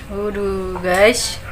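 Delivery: slow gasp at the heat; tasting something too spicy; mouth burning from spicy food. Real, not acted. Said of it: "Pedes banget nih guys".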